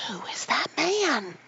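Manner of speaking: slow, startled loud whisper; boy whispering in sudden fright; alarmed whisper. Acted. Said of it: "loud, suddenly alarmed whisper".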